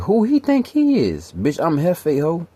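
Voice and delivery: high, slow, scornful friend voice; imitating her friend. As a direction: drag it out high like she's looking down on him. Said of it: "her friend was looking at me like".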